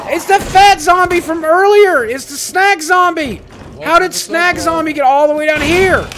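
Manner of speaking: very high, loud, drawn-out disbelief; shocked yelling. Real, not acted. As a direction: yell it slowly in horrified surprise. Sudden zombie reappears.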